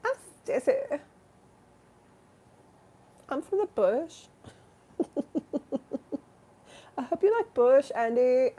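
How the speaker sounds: high-pitched silly character voice; Stacy. Acted. Stacy introducing self to Andy, high silly voice. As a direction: put on a high, comic introduction.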